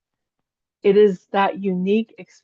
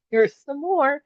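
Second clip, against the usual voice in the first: laughing; 7 semitones higher, slower than usual. Retold or imitated. New gifts keep opening, laughing.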